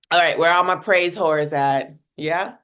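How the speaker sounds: rally the room like a hype host; playful call-out. Real, not acted. Host calling out to her audience, loud and playful.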